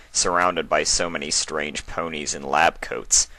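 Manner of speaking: ordinary delivery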